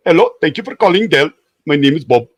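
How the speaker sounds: high-pitched imitation Indian accent; mock Indian accent phone greeting. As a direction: imitate an Indian call-centre agent. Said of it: "in their own accent".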